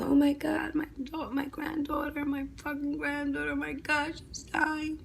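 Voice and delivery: high, soft, breathy wail of grief; a hushed, strained imitation of a screaming grandmother; imitating a scream. Grandmother on the phone hearing granddaughter died, mock scream held back. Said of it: "And she was screaming".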